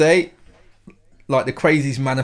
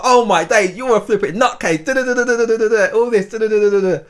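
raised pitch, quick exasperated telling off; mock outraged rant; 7 semitones higher than usual. Friend retelling his furious text to the girl, higher mock outrage. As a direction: rant at her like she's crazy. Retold angry text reply.

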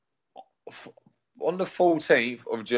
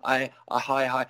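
good little guy; 3 semitones higher than usual. Retold or imitated. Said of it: "trying to be such a good little fucking guy".

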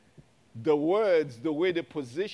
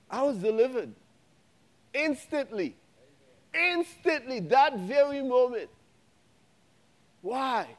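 rising, insistent preacher cadence; preaching; 5 semitones higher, 4 dB louder than usual. Acted. Pastor preaching to the church and viewers, fervent. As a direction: build the crowd up with conviction. Climax of testimony.

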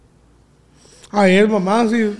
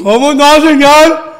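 louder, higher-pitched retort; loud insistence; 5 semitones higher, 9 dB louder than usual. Real, not acted. [name] claims the host works for him, loudly insistent. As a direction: insist loudly on being in charge. Mock quarrel over who is boss.

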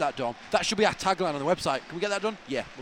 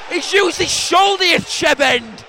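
slow, loud, high drawn-out yell; furious fan heckle; protesting shout; 10 semitones higher, 8 dB louder, slower than usual. Real, not acted. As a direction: bellow it at the pitch, stretching the words. Yelling at match incident.

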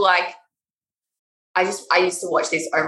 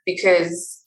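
ordinary talk, nothing marked

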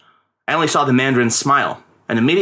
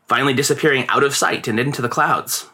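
usual speaking voice

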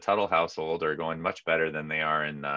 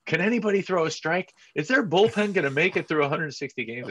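high, anxious fan voice; panicky fan; 11 semitones higher, 4 dB louder than usual. Retold or imitated. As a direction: fret like a worried fan. Mimicking panicked fans.

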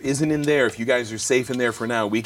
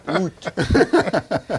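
laughing "Oot, oot" in a mock Canadian vowel, higher; 7 semitones higher, 4 dB louder than usual. Player mocking a Canadian accent with laughter. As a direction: tease the Canadian sound. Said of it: "my Canadian accent".

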